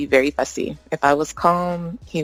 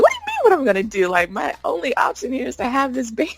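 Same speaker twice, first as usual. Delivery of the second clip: incredulous; 8 semitones higher, faster than usual. Retold or imitated. Retold indignant reply to boyfriend.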